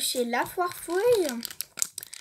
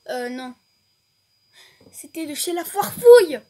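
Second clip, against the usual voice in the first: normal talking voice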